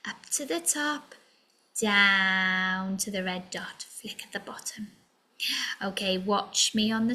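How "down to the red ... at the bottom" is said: a soft, fast unvoiced whisper; whispered direction. Teacher whispering the last stroke of the letter. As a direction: hush it as you trace.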